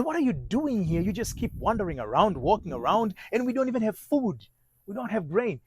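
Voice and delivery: exasperated scolding. Retold or imitated. Father to his idle children with no grain, exasperated. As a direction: scold them in rising exasperation, as if retelling it.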